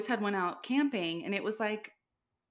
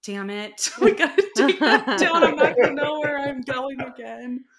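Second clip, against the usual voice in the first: laughing, higher and much louder; giggling mock despair; laughing frustration; 6 semitones higher, 13 dB louder than usual. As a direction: laugh through the complaint. Frustrated about the Halloween landmark.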